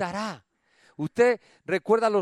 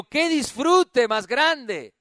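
slow exclamation; 5 semitones higher, 5 dB louder, much slower than usual. Shown as a demonstration. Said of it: "Diga conmigo".

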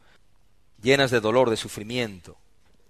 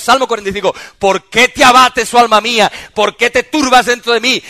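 much louder and higher sermon voice; full-force preaching; 9 semitones higher, 12 dB louder than usual. Real, not acted. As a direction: thunder it at the congregation.

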